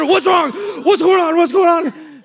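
loud, high alarmed shouting; retold shout of a startled father; startled dad shout. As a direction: bark it like a dad jolted awake. Father jolted awake shouting.

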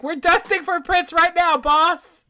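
high, loud mock report to the boss; mock eager rookie cop. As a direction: call it out like an overeager rookie. Mocks eager cops reporting to boss.

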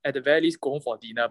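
ordinary talk

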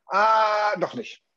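a bright, sudden "Ah, doch nicht"; sudden change of mind. Shown as a demonstration. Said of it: "sagt aber nicht mittendrin". (plötzlich umentschieden)

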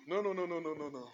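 usual speaking voice

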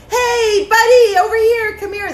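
high, loud shout to someone; loud call. Shown as a demonstration. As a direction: call out across the room. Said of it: "That's a little strong".

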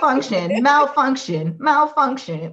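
robot voice repeating malfunction, rising; glitching robot saying error; error message. Pretend. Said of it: "and then they like".

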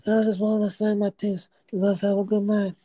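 high mock mob godfather voice. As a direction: ham up the old godfather. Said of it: "Godfather Don".

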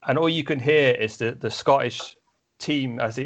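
usual speaking voice

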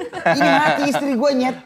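high, panicky half-laughing cry; mock panic. Husband retells panicking at the nurse during delivery, high laughing panic. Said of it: "Susternya gue gituin".

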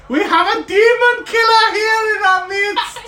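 high, slow, proud proclamation; excited announcement. Acted. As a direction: announce a hero to the room. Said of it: "He's like".

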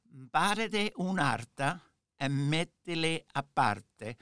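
slow Neapolitan Italian saying; Neapolitan accent. Retold or imitated. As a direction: recite it like a father from Naples. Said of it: "that's in a very Neapolitan accent".

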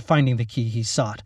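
usual speaking voice

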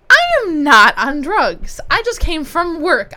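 loud, slow denial; indignant protest. Real, not acted. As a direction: insist you're not on drugs. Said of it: "you're also probably on drugs".

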